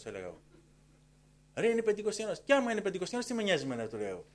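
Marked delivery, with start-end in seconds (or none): 1.5-3.9 high, loud dismissive comeback; acted-out defiant reply; retold retort. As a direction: argue back loudly, pitched up. Acted retort to warning colleagues.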